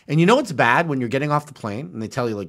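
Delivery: ordinary talk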